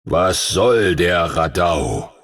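low, slow rumbling dragon voice; booming old dragon. A narrator's reading. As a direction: let it roll slowly through his head, deep and heavy. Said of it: "donnerte eine Stimme durch seinen Kopf".

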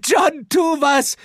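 very high, pleading woman's cry; frantic Shao. Acted. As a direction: beg him desperately. Shao begs John.

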